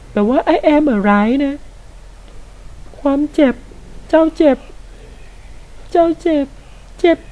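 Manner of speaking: high, slow put-on voice of a game character. Gamer mimics the game boss's pain line, high put-on voice. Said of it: "ทำไมกูต้องทำเสียงแบบนี้ด้วย".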